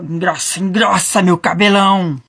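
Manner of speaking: put-on triumphant old hag yelling her spell; witch shouting. A narrator's reading. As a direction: bellow the charm in a witch voice. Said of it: "E a velha gritou".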